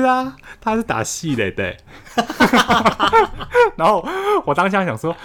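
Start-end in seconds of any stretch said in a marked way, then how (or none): 1.8-3.7 high, loud laughing burst while telling a funny roommate story; burst of laughter. Real, not acted. A storyteller laughs at her own prank, high loud laugh.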